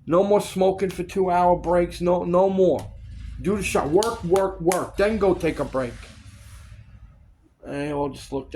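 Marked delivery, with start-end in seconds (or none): 0.1-5.9 loud retold tirade, easing slightly at the end; crazy on-set rant. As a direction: re-enact ordering the crew back to work. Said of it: "I went crazy one day on set".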